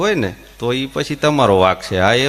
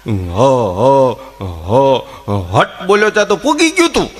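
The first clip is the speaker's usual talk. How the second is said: mock stammer; 3 semitones lower than usual. Stammerer tries to say hat.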